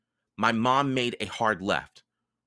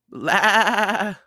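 goat bleat; 11 semitones higher, 8 dB louder than usual. Acted. Grover bleats again.

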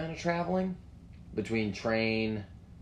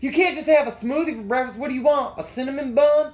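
high, loud mock mom voice; scolding mom; 14 semitones higher, 7 dB louder than usual. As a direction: scold your son in a hammed-up, high voice. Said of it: "and she's like".

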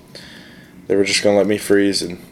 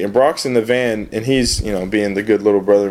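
normal talking voice

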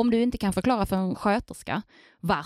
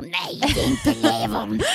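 laughing mock correction; 3 semitones higher, 4 dB louder, slower than usual. Acted teacher correcting student, laughing.

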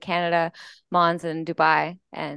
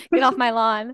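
mock grumpy old man yell; shouting at trespasser; 4 semitones higher than usual. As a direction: yell it at kids on your lawn. Yelling off trespasser.

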